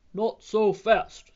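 loud, slow challenge; big tough dinosaur. A narrator's reading. Bob stands up to the T-Rex, booming defiance. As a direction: plant each word loudly.

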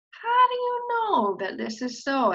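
high, odd-toned questioning voice; curious jackrabbit. Acted. Jackrabbit questions the hen's alarming news. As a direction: ask it puzzled.

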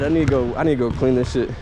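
normal talking voice

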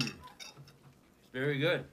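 slow, mumbled through a mouthful of food; mouth full. Real, not acted. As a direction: savour it while still chewing. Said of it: "I didn't want to talk with my mouth full".

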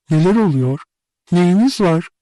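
high, astonished questioning; bewildered curiosity. A narrator's reading. Nastenka stops crying to question him, bewildered. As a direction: ask in puzzled alarm. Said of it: "Garip bir merak şaşkın gözlerinde".

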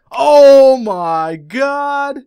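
loud, high, drawn-out exclamation; amazed outburst. Real, not acted. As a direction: cry out in astonishment at a gift. Amazed by the present.

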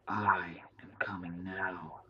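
slow whispered entity voice; slow ominous whisper; alien whisper. A narrator's reading. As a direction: hiss the approach softly. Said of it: "the screen said".

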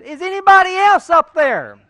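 loud, high desperate shout; comic cry for a second opinion; punchline yell. Retold or imitated. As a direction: bellow the punchline skyward. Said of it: "and he said".

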